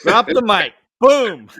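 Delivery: loud, high punchy exclamation; emphatic cheer. Real, not acted. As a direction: punch each phrase out in real approval. Listener cheers the story's punchline.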